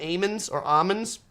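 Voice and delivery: first "almonds" as "ay-mins", second "almonds" as "ah-mins"; almonds without L. Retold or imitated. Vlogger asking growers, puzzled, "almonds" said as "ay-mins or ah-mins". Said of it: "they don't pronounce the L".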